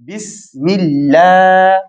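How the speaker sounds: Bismillah drawn out very slowly; slow syllable demo. Shown as a demonstration. Teacher drawing out Bismillah for the class, very slow. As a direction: stretch Bismillah for learners to copy. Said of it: "laam fatxaa alif sagiira".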